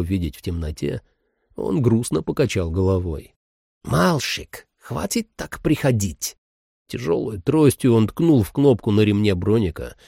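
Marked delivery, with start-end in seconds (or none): 3.8-6.4 put-on strange old man with an accent; lightly voiced old man, slow. Dream old man speaks.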